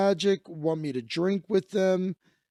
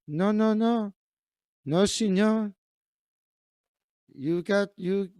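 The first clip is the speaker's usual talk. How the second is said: put-on a light Mexican accent, insisting, higher; 5 semitones higher, slower than usual. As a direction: refuse to let him leave, lightly Mexican. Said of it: "likes my Mexican voice".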